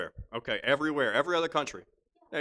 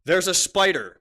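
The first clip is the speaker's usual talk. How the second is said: loud, raised game-show opening; loud announcing; 4 semitones higher, 7 dB louder than usual. Real, not acted. Host launches question three, announcing loudly. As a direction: announce it big, pitched up.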